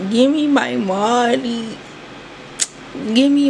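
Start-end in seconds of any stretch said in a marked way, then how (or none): none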